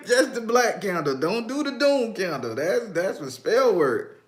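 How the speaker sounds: high voice full of amusement; laughing warning. Real, not acted. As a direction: warn them through your laughter. Laughing warning about doom candle.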